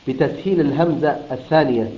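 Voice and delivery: ordinary talk, nothing marked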